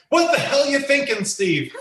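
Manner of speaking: imitating the coach snapping out of nowhere; retold coach yell, pitched high; sudden coach explosion. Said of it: "And just explode".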